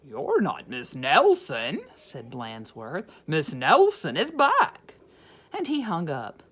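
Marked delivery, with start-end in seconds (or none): 0.0-1.9 lower, louder principal voice; lightly voiced principal. Principal rejecting the caller, lower and louder. 3.3-4.9 higher, louder principal voice; lightly voiced principal. Principal insisting on the phone, higher and louder.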